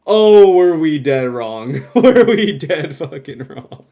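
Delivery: loud laughing disbelief. Real, not acted. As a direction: belt it out laughing at yourself. Laughing at being wrong.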